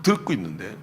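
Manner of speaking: normal talking voice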